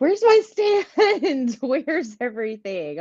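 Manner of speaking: high, drawn-out panicked cry; hammed-up panic, voice leaping up; frantic mock panic. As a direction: play it as a frantic search for your stuff.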